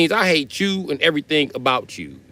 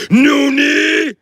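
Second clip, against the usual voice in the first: high, loud villain roar; anime villain shout; 14 semitones higher, 7 dB louder than usual. Pretend. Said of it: "so I can be like".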